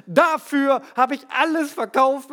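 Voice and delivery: höher und lauter, stolz verkündet; ganz stolz. Retold or imitated. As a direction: der Familie stolz präsentieren. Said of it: "verkündet ganz stolz". (stolz verkündend)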